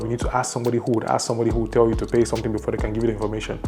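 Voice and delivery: ordinary delivery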